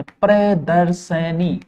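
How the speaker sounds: प्रदर्शनी slowly as pre-dar-sa-nee; slow syllable-by-syllable. Shown as a demonstration. Teacher spelling out the correction, slow careful pronunciation. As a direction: draw out each syllable of प्रदर्शनी. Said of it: "प्रदर्शिनी नहीं होता".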